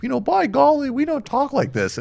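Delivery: high, prim mock senator voice; sanctimonious senator. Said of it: "he's out on the Senate floor being like".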